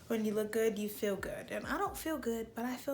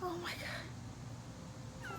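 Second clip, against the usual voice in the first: scared whisper; 9 semitones higher, 8 dB quieter, much slower than usual. Real, not acted. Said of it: "I'm so scared".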